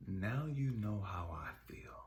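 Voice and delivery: low, hushed reply; quiet, grave voice; God. Retold or imitated. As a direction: say it softly and deep, after a long pause. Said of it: "He said".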